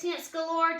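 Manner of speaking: ordinary delivery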